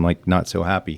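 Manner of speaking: normal talking voice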